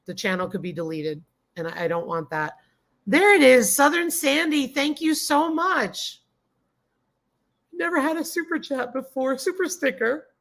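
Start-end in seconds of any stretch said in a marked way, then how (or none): 3.1-10.3 higher, delighted gasp of real surprise; like your first tip just landed; thrilled surprise. Real, not acted. First super chat on the stream.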